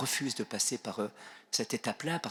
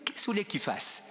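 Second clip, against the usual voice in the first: high, amused mock challenge; imitated laughing defiance; 9 semitones higher than usual. Old bishop scoffing at police, imitated high amused voice. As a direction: imitate someone laughing off a threat. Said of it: "il éclate de rire".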